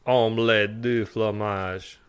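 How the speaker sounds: slow, drawn-out mock French accent; overdone French accent, slow and soft. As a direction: savour the French cliché slowly. Repeats the French phrase slowly.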